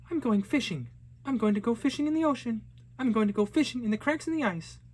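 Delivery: put-on high, fast, bright little girl announcing plans; eager young girl. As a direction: play the excited girl set on fishing. Narrator voices the girl.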